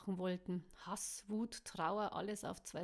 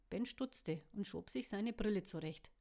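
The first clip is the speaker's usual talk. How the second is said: plain reading voice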